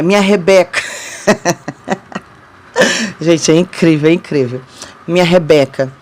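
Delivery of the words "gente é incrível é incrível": laughs, then says the word through it; a real laugh breaking into the word; amused laugh before speaking. Real, not acted. Laughing at her own story.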